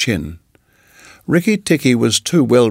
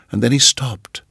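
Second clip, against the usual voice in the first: ordinary delivery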